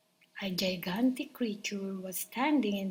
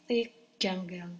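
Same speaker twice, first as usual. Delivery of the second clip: usual speaking voice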